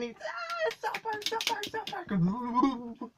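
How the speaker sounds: squeaky cartoon voice; cartoony voice. Shown as a demonstration. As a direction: squeak the name like a tiny cartoon. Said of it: "I did sort of a cartoony thing".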